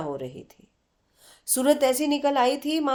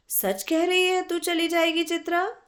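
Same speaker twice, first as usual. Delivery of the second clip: higher, rising question of dismay; sad disbelief; 8 semitones higher than usual. A narrator's reading. Aruna asks her friend if she will really leave, saddened. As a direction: ask as if it cannot be true. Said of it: "उदासी भरे स्वर में".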